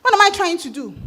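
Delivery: normal talking voice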